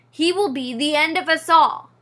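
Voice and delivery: a loud doom-laden cry; shouted. A narrator's reading. Father mouse shouts at his wife about their son, loud doom-laden shout. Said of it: "he shouted".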